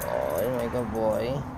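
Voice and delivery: slow soft praise for a pet. Real, not acted. Praising the dog.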